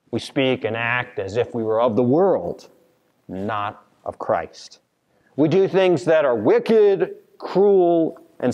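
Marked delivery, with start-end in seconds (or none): none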